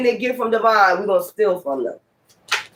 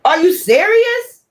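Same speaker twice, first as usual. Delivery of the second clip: incredulous; 8 semitones higher, slower than usual. Real, not acted. Disbelief at thieves' nerve.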